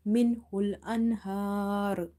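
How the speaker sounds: "Minhul anhar" recited slowly with a heavy r ending "anhar"; slow recitation of "Minhul anhar", pausing on a heavy r; heavy final ra. Shown as a demonstration. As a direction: draw out "anhar" and close on a full, heavy r. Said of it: "still we will make it heavy".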